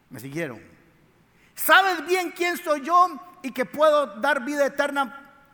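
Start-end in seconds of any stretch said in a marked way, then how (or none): 1.5-5.5 emphatic declaration. Acted. Jesus paraphrased, preacher voicing him.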